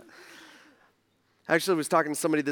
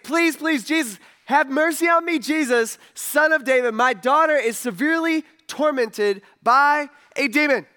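high, loud desperate plea; imitated crying out; 8 semitones higher, 5 dB louder than usual. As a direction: cry out like a pleading mother. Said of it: "she kept crying out and screaming and yelling".

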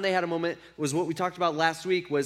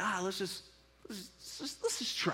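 much softer, near-breathless urging; gentle coaxing; 2 semitones higher, 10 dB quieter than usual. Retold or imitated. Jesus quietly coaxes tired Peter to try, much softer. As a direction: coax them quietly to try once more.

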